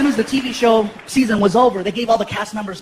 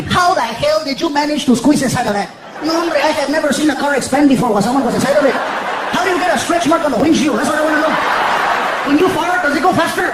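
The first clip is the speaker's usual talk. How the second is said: higher, louder imitation of a needling friend; hammed-up comic pal piling on fat jokes; Paul Rodriguez; 4 semitones higher, 4 dB louder than usual. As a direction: rib him with mock disbelief. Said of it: "Paul especially".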